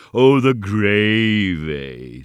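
loud, long-drawn echo, dropping at the end; mocking goblin. A narrator's reading. Goblin repeats the sexton's answer, mocking drawl. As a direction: draw it out in mock understanding.

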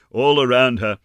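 usual speaking voice

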